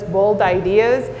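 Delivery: ordinary delivery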